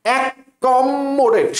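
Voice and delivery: অ্যাকমোডেট very slow, pressing hard on the double c and m; stressed spelling pronunciation. Shown as a demonstration. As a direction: lean on the doubled letters in অ্যাকমোডেট. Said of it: "তাহলে তুমি এখন এইভাবে উচ্চারণ করো".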